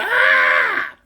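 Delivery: high, drawn-out mock scream; comic cry of agony; imitated holler of pain. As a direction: wail as if being pulled by the leg. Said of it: "the more she hollered".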